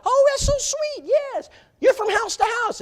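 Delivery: high falsetto imitation of an excited lady; mock high woman's voice. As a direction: squeak it out like a delighted Southern lady. Retelling Brenda's words at door.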